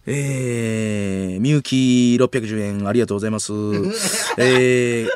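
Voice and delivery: drawling imitated superchat reader; Soshina's rude streaming bit. Comedian reading donations on a livestream, drawling rude impression. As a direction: read deadpan, stretch endings, build to the insult. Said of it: "粗品。 生配信でえぐい失礼ボケ".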